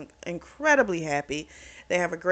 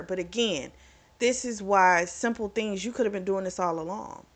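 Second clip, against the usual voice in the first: ordinary talk, nothing marked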